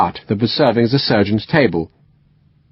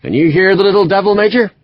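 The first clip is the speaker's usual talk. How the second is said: put-on West Country accent; 9 semitones higher than usual. A narrator's reading. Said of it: "strong West Country accent".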